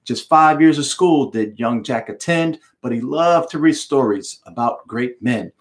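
plain reading voice

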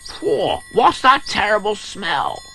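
squeaky disgust; disgusted Squirt. Acted. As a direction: react to the stink. Squirt arrives, smells it.

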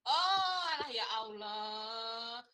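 high, loud, drawn-out oh; surprised exclamation. Real, not acted. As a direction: exclaim in amused disbelief. Reacts to Bagja being Sundanese. (kaget, panjang dan keras)

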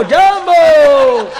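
જાંબો stretched very slow, high and loud with a laugh; long drawn Jambo. Retold or imitated. Mimicking an African greeting, drawn-out loud જાંબો. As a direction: draw જાંબો out slowly. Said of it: "આફ્રિકાના આવેલા".

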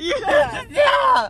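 very high shrieking cry; frightened shriek. Real, not acted. Scared by sharp turn.

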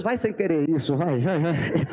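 low, insistent urging; a hint of God pushing forward; lightly voiced firm God. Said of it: "Deus diz".

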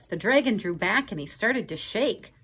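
rising tension in the story; storybook narration. A narrator's reading. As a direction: build the scare for the kids. Narration of dragon fear.

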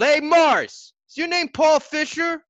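high, loud shout across the field; yelled. A narrator's reading. Teammate yelling across the field, loud and high. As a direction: call out loud and high to a player. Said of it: "came running over and yelled".

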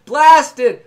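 shouted with a falling end; loud exclamation. Real, not acted. Streamer shouting as the ego is blasted away. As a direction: blast the word out. Exclaiming after explosion image.

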